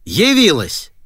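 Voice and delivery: high, swooping angry bark. A narrator's reading. Father greeting his late daughter, barking. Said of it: "прямо рявкнул".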